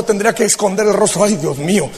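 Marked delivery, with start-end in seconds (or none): 1.2-1.9 mock dismayed God. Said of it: "el Señor diciendo".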